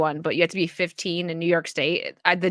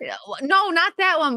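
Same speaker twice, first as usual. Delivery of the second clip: ordinary delivery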